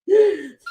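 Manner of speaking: loud high gasp from laughing; sharp gasp. Real, not acted. Streamer cracking up at chat, gasping for air. Catching breath after laughing hard.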